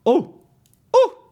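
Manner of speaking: high, loud wounded cry; sharp hurt exclamation; pained yelp. Real, not acted. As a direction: yelp as if punched. Describes being hurt.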